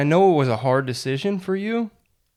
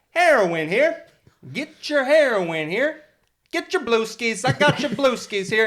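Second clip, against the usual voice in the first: stadium vendor hawking; 11 semitones higher than usual. Pretend. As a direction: sell it like a ballpark hawker. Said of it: "somebody walking up and down those stairs".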